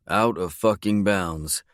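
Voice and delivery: ordinary delivery, nothing marked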